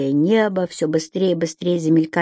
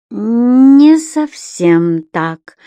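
slow drawn out; 4 semitones higher, 5 dB louder, much slower than usual. A narrator's reading. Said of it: "Муратов произнёс медленно, растягивая слоги".

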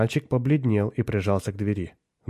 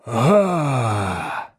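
demon's booming exclamation; thunderous demon cry; 2 semitones higher, 5 dB louder than usual. A narrator's reading. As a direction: cry out like a huge demon, louder. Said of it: "вскричал демон громовым голосом". (громовой крик демона)

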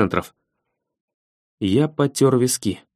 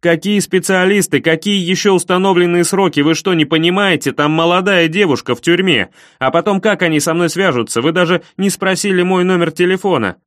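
angry shouting; 4 semitones higher than usual. Acted. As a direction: yell it in disbelief. Furious caller yells at operator.

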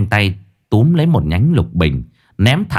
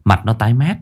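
usual speaking voice

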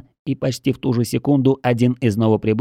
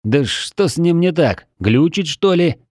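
higher-pitched, miffed questioning; slightly offended; 6 semitones higher than usual. A narrator's reading. Mage puzzled by the stalled lich, a touch offended. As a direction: ask with a note of hurt annoyance. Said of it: "с нотками обиды произнёс маг".

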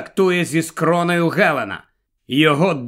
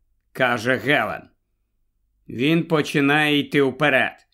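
ordinary delivery

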